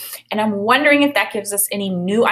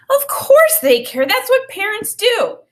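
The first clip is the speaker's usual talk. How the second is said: reassuring; 12 semitones higher, 4 dB louder than usual. Acted. Boy reassures crying friend.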